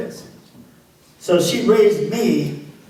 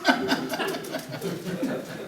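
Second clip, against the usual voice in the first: high airy laugh at himself; hearty laugh; 9 semitones higher, 10 dB quieter than usual. Real, not acted. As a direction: laugh breathlessly at the memory. Recalling his tough-guy pose.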